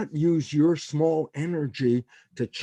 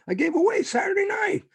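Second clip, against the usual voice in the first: sudden high shout of frustration; frustrated outburst. Retold or imitated. Fed up after a boring party, shouting to himself. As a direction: yell it out, fed up at the wasted night.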